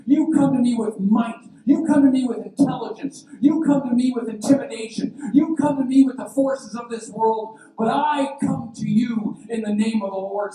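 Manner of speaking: loud, high, ringing defiance; full-force preaching. Acted. Preacher restating David's challenge to the church, shouting defiantly. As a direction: hammer out each phrase at full volume.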